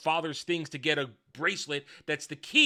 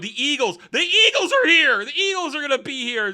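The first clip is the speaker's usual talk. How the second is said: sarcastic hype, much higher and louder; mock excitement; 10 semitones higher, 6 dB louder than usual. Vlogger mocking the show's eagle payoff, hammed-up excitement. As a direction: fake thrill at the eagles arriving.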